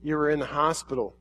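soft, hushed, lingering words of a loving God; tender voice of God. Acted. God speaking softly to the crowd, tender and hushed. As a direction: speak as God gently calling his people. Preacher voicing God to congregation.

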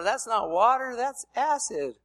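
high, slowed mock disgust; disgusted protest.

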